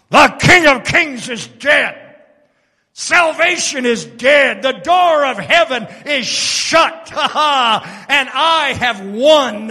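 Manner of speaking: loud, triumphant devil's boast; gloating Satan. Acted. Preacher playing Satan for the congregation, loud gloating boast. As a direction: crow over the victory.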